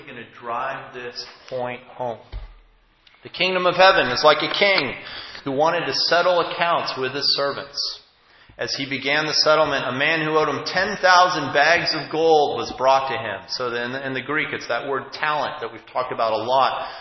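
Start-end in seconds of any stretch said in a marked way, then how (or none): 3.2-13.4 loud, weighty reading of the parable; scripture reading. Acted. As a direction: proclaim the scripture to the congregation. Reads the parable aloud.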